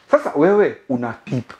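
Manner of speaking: ordinary talk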